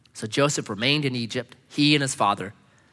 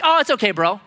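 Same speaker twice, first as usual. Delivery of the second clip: mock breezy forgiveness, raised pitch; higher, offhand bro voice; casual brush-off; 6 semitones higher, 4 dB louder than usual. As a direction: wave it away like a laid-back buddy.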